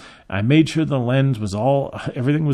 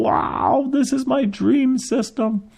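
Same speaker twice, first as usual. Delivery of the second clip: high, drawn-out dreamy amazement; jaw-dropped wonder in a high voice; awestruck drooling wow; 12 semitones higher, slower than usual. Retold or imitated. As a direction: gush in awe, pitched way up. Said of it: "my jaw is dropped. The drool's going out".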